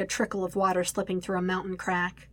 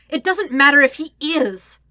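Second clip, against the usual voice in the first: loud, higher-pitched angry insistence; heated retort; 7 semitones higher, 9 dB louder than usual. A narrator's reading. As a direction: argue back forcefully. Heated quarrel over the pinned man.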